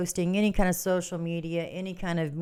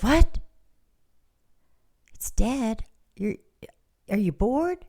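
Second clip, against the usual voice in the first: higher, louder surprised outburst; shocked what; 4 semitones higher, 4 dB louder than usual. Retold or imitated. Cookie shocked that the bar seems dead. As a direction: jump up high on what. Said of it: "And she goes".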